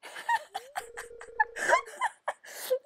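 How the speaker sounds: real, high-pitched helpless laugh; teary squeal of laughter; high laughing fit. Real, not acted. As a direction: break up laughing. Laughing at Lewis's description.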